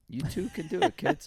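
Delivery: put-on cheesy after-school special, aimed at kids; mock PSA voice.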